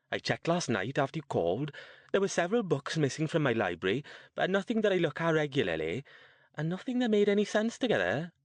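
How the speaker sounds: high, quick voice of a woman about sixty; older woman character. Acted. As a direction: play an older lady, pitched well up and brisk.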